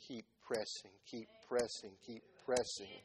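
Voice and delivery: soft, low, repeated encouragement; steady, hushed exhortation, each time alike; gentle urging. Real, not acted. Preacher urging a friend to persist, gently.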